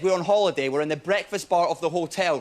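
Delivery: ordinary talk, nothing marked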